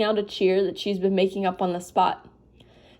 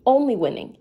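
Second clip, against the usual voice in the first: usual speaking voice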